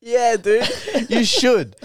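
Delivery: words through a laugh; giggly agreement. Real, not acted. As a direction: talk while still laughing. Laughing after joke.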